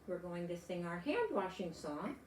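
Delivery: ordinary delivery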